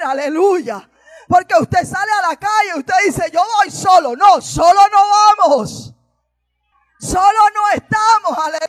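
fiery preaching. Acted. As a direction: preach it out to lift the congregation. Preaching at full force.

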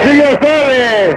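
drawn-out high call; host's call-out. Real, not acted. Host passing the contestant after a joke, calling out. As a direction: stretch it out in a high pitch.

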